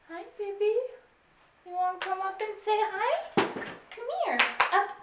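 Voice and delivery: high, loud doggy voice; sing-song pet talk. Real, not acted. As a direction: coo up high at the puppy. Talking to her dog.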